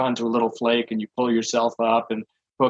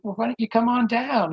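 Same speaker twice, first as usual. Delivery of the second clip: higher, gently coaxing voice; coaxing negotiator; 12 semitones higher than usual. Retold or imitated. Negotiator coaxes the climber to come down, higher gentle voice. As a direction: coax him down kindly, pitched up.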